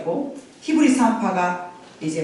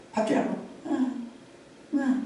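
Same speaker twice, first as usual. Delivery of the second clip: put-on feeble baby cry; 3 semitones higher, 10 dB quieter than usual. Shown as a demonstration. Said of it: "요렇게는 안 울었을 거 아니야".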